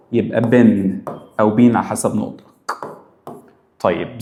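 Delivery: usual speaking voice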